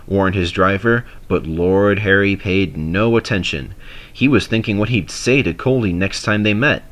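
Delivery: usual speaking voice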